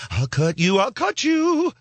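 high mock menacing repeat; tough guy threat. Cat on the street threatens, high mock menace. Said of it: "he says".

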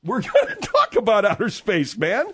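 high-pitched, laughing echo; laughing at him. Acted. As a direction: repeat it mockingly through laughter. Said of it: "I'm laughing at you".

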